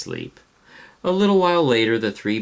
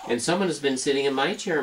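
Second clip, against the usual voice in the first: higher, fast medium-sized bear; Mama Bear complaining, pitched up and quick; 7 semitones higher, faster than usual. Acted. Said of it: "in her medium-sized voice".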